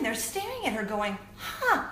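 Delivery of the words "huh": higher, puzzled huh; baffled that she can't; surprised. Retold or imitated. Mother and daughter failing at it.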